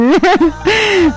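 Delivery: high trailing giggle; real giggle. Real, not acted. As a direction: giggle after the joke. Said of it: "Van a disculpar que yo me ría así".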